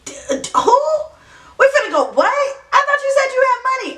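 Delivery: high, fast, stammering outrage; stunned disbelief. Retold or imitated. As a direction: sputter like you can't believe your ears. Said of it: "I'm like".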